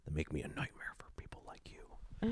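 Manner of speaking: menacing movie threat dropping to a whisper; hushed action-hero impression, trailing into breath; Liam Neeson. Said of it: "the thing Liam Neeson says".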